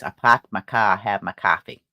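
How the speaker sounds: put-on Boston accent, the R's dropped in park and car. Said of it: "If you're a Bostonian, it's".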